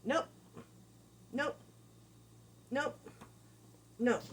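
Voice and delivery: spaced, even nopes; calm nopes. Shown as a demonstration. Trainer calmly correcting the dog, spaced nopes. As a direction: say each nope calmly with a pause. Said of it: "a nice flowing".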